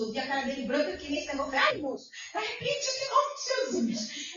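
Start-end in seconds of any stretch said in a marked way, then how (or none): 1.6-4.4 high, pleading cry to her husband; urgent plea. Retold or imitated. Wife pleading high with her sick husband in the clinic. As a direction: beg him urgently, voice jumping up high.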